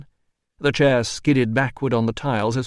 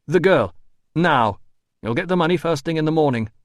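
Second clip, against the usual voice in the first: raised, forceful order; urgent demand; 6 semitones higher, 5 dB louder than usual. A narrator's reading. Doctor demanding the girl's release, raised and urgent. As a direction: push for it at once.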